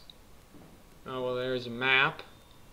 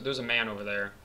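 ordinary talk

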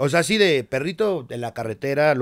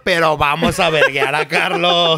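hammed-up cheerful thug, louder; mock aggressor; 6 dB louder than usual. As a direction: play the carefree brute, slowing at the end. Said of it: "Así de". (imitando a un agresor despreocupado, más fuerte)

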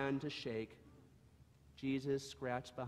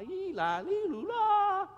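broken bray; 11 dB louder than usual. Acted. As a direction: let the hee-haw break into a squeak. Howie's broken bray retold.

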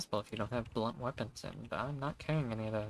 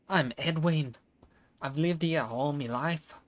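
louder, slow mock Scottish farmer; Scottish accent; 4 semitones higher, 6 dB louder, slower than usual. Voicing the village elder Edwin in a hammed-up Scottish accent. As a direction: lay on a Scottish burr, slowly. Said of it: "the fuck's with the way this dude's talking".

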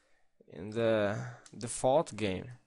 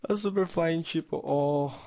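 excited; 7 semitones higher than usual. Real, not acted. Excited at a game item.

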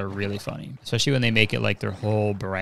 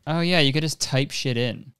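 normal talking voice

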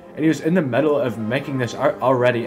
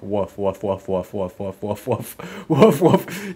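dog barks; 4 semitones lower, slower than usual. Pretend. Gamer barking along with the hellhound game, low woofs.

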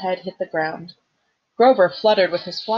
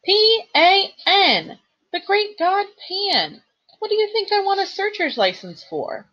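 indignant spelling out; 13 semitones higher than usual. Acted. Grover indignantly spelling the god's name to Percy. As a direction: spell it out, outraged. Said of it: "he cried indignantly".

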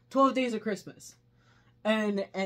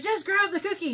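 impatient shout; 12 semitones higher, 5 dB louder than usual. Retold or imitated. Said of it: "she's like".

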